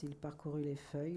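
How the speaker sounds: ordinary delivery, nothing marked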